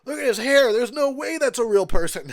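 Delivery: a half-joking alarmed shout; mock yell. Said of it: "I'm like".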